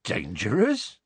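mildly puzzled question; a touch of bemusement; genially bemused. A narrator's reading. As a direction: query the word with good-natured puzzlement. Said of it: "looking genially bemused".

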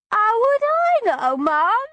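high, tearful Cockney whine. Acted. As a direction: let tears break into the Cockney voice. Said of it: "Tears in my tone".

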